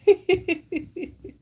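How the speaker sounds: long high giggle; high, tittering laugh. Real, not acted. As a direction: giggle at your own joke. Laughs at own joke.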